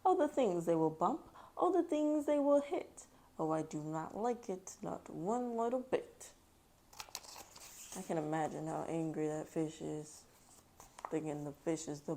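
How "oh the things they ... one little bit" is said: put-on lively, louder read-aloud voice for kids; story reading aloud. A narrator's reading. Mom reading Dr. Seuss to her kids, lively read-aloud. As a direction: read the rhyme to a child with bounce.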